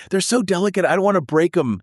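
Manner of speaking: high, fretful voice for the nervous guy; timid quote. Retold or imitated. Host quoting a nervous caller, high and fretful. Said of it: "He was like".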